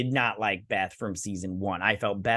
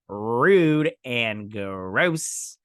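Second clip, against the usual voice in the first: Rude and gross drawn out very slowly; slow mock disgust; slow disgusted verdict; 3 dB louder, much slower than usual. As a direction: spell out Rude and gross, unimpressed. Her own retorted verdict.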